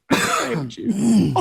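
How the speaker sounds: put-on slow, tearful parody of an old actor; hammed-up crying; mock crying impression. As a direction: imitate a weepy, drawn-out confession. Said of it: "when he's crying. He goes".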